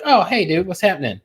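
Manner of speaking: a touch of forced cheer; mild cheerful greeting. Retold or imitated. Answering a friend's call, mildly upbeat hello. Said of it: "No, you're like".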